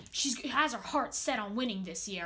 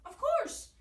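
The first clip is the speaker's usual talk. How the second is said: excited; 14 semitones higher, 5 dB louder than usual. Acted. Admits telling them.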